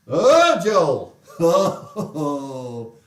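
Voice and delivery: loud, high "oh" sinking into laughter; mock swooning. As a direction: cry out in mock adoration, then laugh. Mock swoon after the preacher impression.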